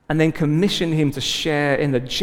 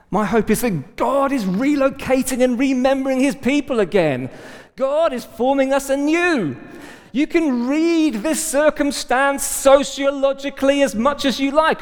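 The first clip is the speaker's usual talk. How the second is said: raised, insistent preacher's delivery; impassioned preaching; 9 semitones higher than usual. Real, not acted. Preacher telling the church God is forming them anew, impassioned. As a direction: preach it out with conviction, pitched high.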